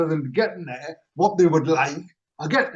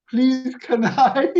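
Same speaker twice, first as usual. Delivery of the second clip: slow, higher-pitched polite plea; simple polite request; 5 semitones higher, 3 dB louder, much slower than usual. Retold or imitated. Customer re-enacts his order to worker, polite. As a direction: ask sweetly and slowly, re-enacting innocence. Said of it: "I'd simply said".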